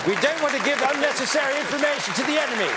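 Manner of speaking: high, fast mock-secretive patter; comic wartime informant, pitched well up; mock wartime code talker. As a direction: rattle it off high and quick like a wartime caricature.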